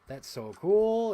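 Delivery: high, loud, slow gush; drawn-out delighted gush; excited. Real, not acted. Collector thrilled by a toy, gushing slowly. Admiring a Burger King toy.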